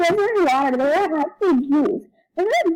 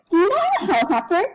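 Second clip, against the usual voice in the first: ordinary talk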